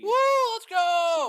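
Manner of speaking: very loud, high whoop; whooping cheer. Real, not acted. Co-host celebrating the working stream, whooping cheer. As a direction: shout it out in celebration.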